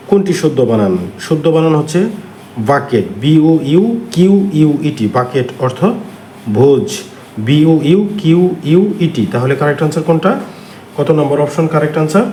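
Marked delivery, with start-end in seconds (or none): 3.2-5.1 slow letter-by-letter spelling; teacher slowly spelling a word. Shown as a demonstration. 7.4-9.3 slow letter-by-letter spelling; teacher slowly spelling a word. Shown as a demonstration.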